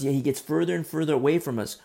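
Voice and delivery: usual speaking voice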